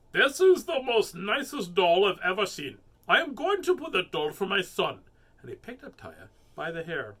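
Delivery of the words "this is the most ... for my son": loud, pitched-up character voice; story man. A narrator's reading. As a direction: announce it loudly as an emphatic shopper. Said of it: "A man came along and looked at Taya and said".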